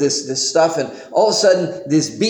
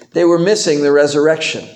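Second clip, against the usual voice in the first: ordinary talk, nothing marked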